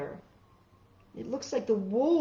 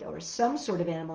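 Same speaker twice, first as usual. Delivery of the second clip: ordinary delivery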